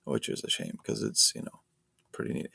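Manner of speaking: whispered aside. Shown as a demonstration. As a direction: breathe it, barely voiced. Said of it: "repeat in whisper".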